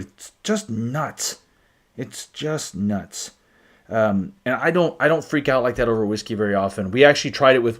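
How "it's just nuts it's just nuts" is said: awed hush. Real, not acted. Awed by the whiskey's flavor.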